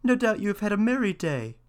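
high-pitched mouse; mouse character. A narrator's reading. Mouse asks after the cat's day, high voice. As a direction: chat as the mouse, pitched well up. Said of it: "said the mouse".

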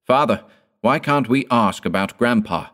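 put-on a frightened child questioning father; surprised and fearful. A narrator's reading. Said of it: "with an expression of surprise and fear".

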